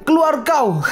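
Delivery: laughing through the line; mock order dissolving into a laugh. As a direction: break into laughter while ordering out. Kicked out, narrator laughs.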